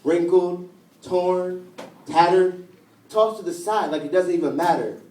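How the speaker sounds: measured recital, each word set apart; spoken-word poem. Acted. Poet likening own heart to a paper rose, spoken-word style. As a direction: place each image slowly and deliberately.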